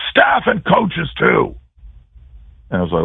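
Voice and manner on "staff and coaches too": high, slow carrying call, retold; coach calling out. Coach summons staff and coaches too, slow high call. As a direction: draw out each word to summon everyone. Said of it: "and he went".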